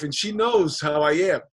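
ordinary talk

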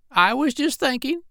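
put-on very high old parrot voice; the parrot musing to the doctor, very high voice; parrot Polynesia. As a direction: play the parrot way up in the head. Parrot character in story.

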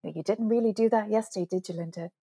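soft, quick inner-voice chiding; mock telling-off under your breath; self-reproach. Coach voicing her own conscience, gently chiding. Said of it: "I hear myself going".

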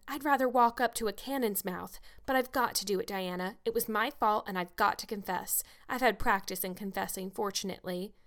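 plain reading voice

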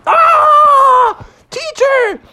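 high, loud yelp for help; panicked Froggy. Acted. Bitten Froggy shouts to the teacher, high and loud. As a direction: scream for the teacher in mock panic.

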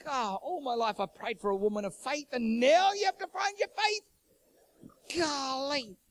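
hammed-up, high-pitched lament; mock exasperation. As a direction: wail the complaint, climbing steeply at the end. Exasperated husband in retold quarrel.

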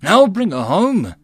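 slow, loud, high-pitched vow; solemn. A narrator's reading. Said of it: "Jutsu said solemnly".

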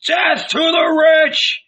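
high, loud, drawn-out slogan; shouted. A narrator's reading. Ragamuffins rallying to loot Zebedee, shouted. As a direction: shout it as the mob's battle cry.

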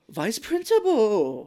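high, loud, slow startled gasp of a line. Retold or imitated. Mimicking a startled teacher, laughing gasp. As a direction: caught off guard, half laughing.

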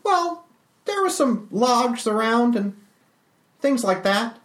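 squeaky high character voice, a bit slow; high Calvin voice. Acted. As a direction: voice the little construct brightly.

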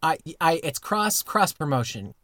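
ordinary delivery

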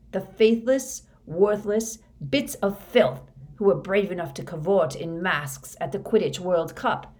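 bitter tirade with loud bursts; scornful rant. Acted. Moody rails at faithless Death Eaters, scornful. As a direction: spit out contempt on the key words. Moody rants about disloyal followers.

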